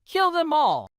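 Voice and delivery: read-aloud crowd yell. Acted. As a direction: shout it from the crowd. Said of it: "yelled more".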